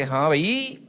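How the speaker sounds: adult talk. Retold or imitated. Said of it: "normally बात नहीं कर सकते".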